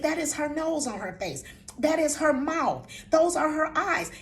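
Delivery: insistent grandmother telling off; stern reprimand. Retold or imitated. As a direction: lay it out firmly for a child. Retelling her scolding of grandson.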